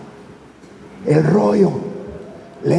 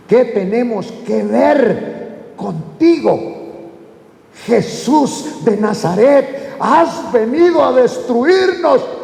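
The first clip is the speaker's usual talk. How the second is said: slow, stretched declamation as the demon; possessed man's measured outcry; demon crying out; 4 semitones higher, slower than usual. Acted. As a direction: draw out each phrase, building to a higher cry. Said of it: "comenzó a gritar".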